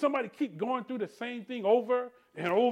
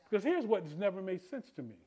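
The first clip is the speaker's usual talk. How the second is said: ordinary talk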